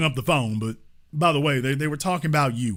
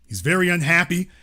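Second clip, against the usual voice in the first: normal talking voice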